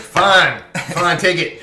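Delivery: high, loud, exasperated giving-in; grudging surrender. Acted. Nash handing over the ring under pressure, grudging. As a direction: give up loudly with a laugh behind it. Nash gives in after intimidation.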